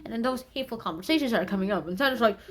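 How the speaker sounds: usual speaking voice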